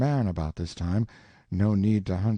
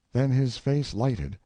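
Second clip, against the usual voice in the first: ordinary delivery, nothing marked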